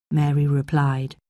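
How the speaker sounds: usual speaking voice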